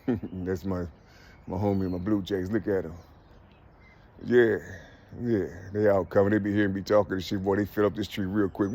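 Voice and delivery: low, soft murmur to the birds in the tree; soft aside to birds. Real, not acted. Man admiring Blue Jays gathering in his tree, in a low soft murmur.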